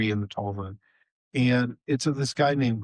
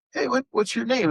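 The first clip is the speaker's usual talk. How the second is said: much higher, eager call; Honi calling out; 13 semitones higher than usual. Retold or imitated. Honi calls to the grandson.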